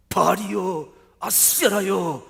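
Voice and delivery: high, very loud wail to the gods; desperate screaming cry. Acted. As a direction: scream out to your idols, dragging the last name. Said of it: "마치 절규하듯 부르짖지요".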